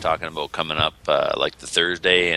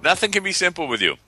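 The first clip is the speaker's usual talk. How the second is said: high exasperated whine; playful complaint; mock exasperation; 13 semitones higher than usual. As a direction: tease him in a pitched-up whine. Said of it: "mock exasperation at co-host".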